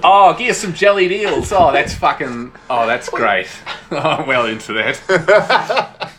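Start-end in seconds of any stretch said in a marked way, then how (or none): 0.0-3.5 loud, hammed-up Cockney; Cockney bluster, easing off at the end; Cockney accent. Shown as a demonstration. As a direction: play a Cockney calling for food. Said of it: "I'm getting Cockney in my head".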